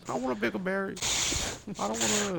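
high whiny complaint. Retold or imitated. Whiny caveman quote.

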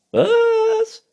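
very high, loud „Was?“; Waschbär. A narrator's reading. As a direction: squeal it as the raccoon. Raccoon figure, shrill question. (hoher, lauter Waschbär-Schrei)

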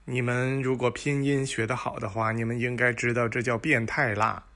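ordinary talk, nothing marked

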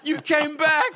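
very high, loud, slow joyful shout; overjoyed cry. Retold or imitated. Author greeting returning fans, ecstatic loud high shout. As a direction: call it out like a long-lost reunion. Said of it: "And I'm just going".

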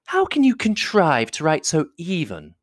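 high, slow society lady voice; high-pitched, drawn-out flattery; gushing flatterer. A narrator's reading. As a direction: coo admiringly over his letter. Miss Bingley admires Darcy's writing.

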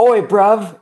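high, loud mock Cockney; Cockney accent. Host imitating co-host's Cockney voice, high and loud. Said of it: "in a Cockney accent".

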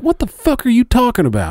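high, exasperated disbelief; incredulous outburst. Retold or imitated. Young worker answering the boss about ear hair, high and incredulous. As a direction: squeak it out in disbelief. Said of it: "And I was like".

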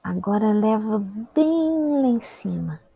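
"bem" drawn out and swelling upward; rising with movement. Real, not acted. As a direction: stretch BEM out high and loud. Guiding shoulders up, voice rises along.

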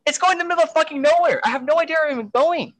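high, fast panicked rush. Retold or imitated. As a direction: blurt it out in rising panic. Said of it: "How I really started panicking".

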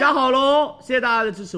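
high and loud restock call; excited announcement. Real, not acted. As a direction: shout out that stock is back.

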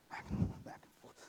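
unvoiced, under the breath; whispered. Pacing son muttering, whispered repetition. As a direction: whisper it as he paces.

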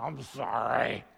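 low, mumbled sorry forced out; sulky kid barely saying sorry; child forced to apologise mumbles; grudging child apology. Retold or imitated. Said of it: "It barely comes out of his mouth".

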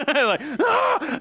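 high squealing "Ah!" through a laugh; mock startled scream. Telling the congregation about the lizard, a laughing mock shriek. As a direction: shriek like something landed on your ear.

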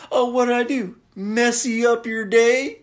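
hammed-up mock taunt; Otis mocking. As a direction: taunt her like the killer. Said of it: "He's like".

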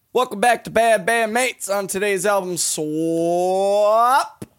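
loud, high host announcement, last word stretched out and rising; show intro shout. Pretend. Host opening the album swap show, big shouted intro. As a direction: belt out the intro, dragging the final word upward.

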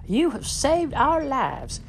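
very high, slow, overjoyed; a high, drawn-out cry of thanks; grateful exclamation. Acted. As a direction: cry out in relief. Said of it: "they exclaimed".

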